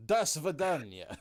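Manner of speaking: slow, mock-Russian "Das Vidanya"; Russian accent. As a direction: ham up a Russian goodbye. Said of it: "something a little more Russian".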